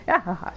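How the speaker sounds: a small sheepish "Gahaha"; subdued laugh. Acted. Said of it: "No, sorry".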